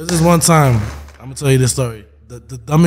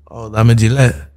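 put-on dad's Spanish accent; 4 semitones lower than usual. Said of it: "I said it in his accent".

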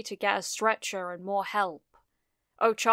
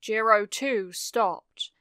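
ordinary delivery

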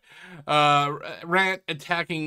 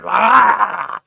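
mock scream; 3 semitones higher, 10 dB louder than usual. Mock horror reveal scream.

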